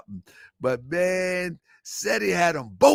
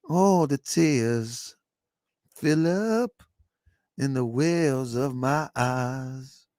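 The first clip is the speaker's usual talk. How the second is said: mock lament; 2 semitones lower, slower than usual. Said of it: "I wanna cry".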